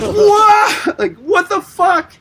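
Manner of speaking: high, loud, startled yelp; shocked shout. Retold or imitated. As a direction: blurt it out in total shock. Kicking door open, ten cops.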